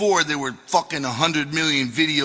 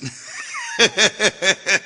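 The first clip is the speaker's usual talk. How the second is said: high laugh at his own bad accent; amused giggle at himself; 5 semitones higher than usual. Real, not acted. Narrator amused by his own failed English accent, laughing.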